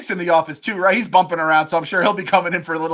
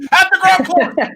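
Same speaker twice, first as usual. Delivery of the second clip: higher and louder, pushing the idea home; excited repeat; 11 semitones higher, 6 dB louder, slower than usual. Real, not acted. Cohost repeating his bullpen plan, excited and louder. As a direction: shout it again with glee.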